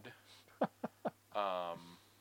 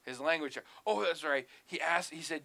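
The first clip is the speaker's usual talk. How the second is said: ordinary delivery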